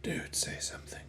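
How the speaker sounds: urgent hushed whisper. Real, not acted. Nudging a silent friend, whispered. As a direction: prod him under your breath.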